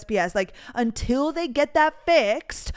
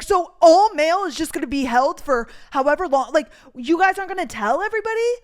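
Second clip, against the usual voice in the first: loud, higher disbelieving challenge; indignant; 5 semitones higher, 6 dB louder than usual. Retold or imitated. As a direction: speed up into an incredulous question. Retold challenge to the leasing office.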